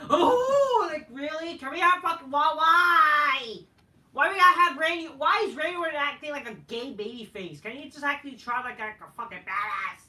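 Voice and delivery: high, loud complaining mock rant; mock outraged fan rant. Fan imitated ranting loudly at babyface Orton. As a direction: whine it out as a fed-up fan. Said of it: "And then R K O, like".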